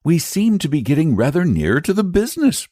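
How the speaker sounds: put-on high boyish voice; a keen young boy chiming in; boy interrupting the grown-ups, high. A narrator's reading. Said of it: "put in the boy".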